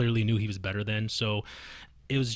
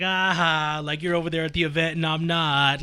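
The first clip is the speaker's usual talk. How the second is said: higher, teasing taunt; mock gloating; 5 semitones higher, 4 dB louder than usual. Player imitating troll who skipped the fight, high gloating taunt.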